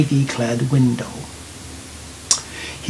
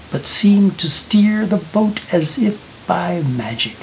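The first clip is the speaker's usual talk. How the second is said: plain reading voice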